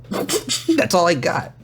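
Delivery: usual speaking voice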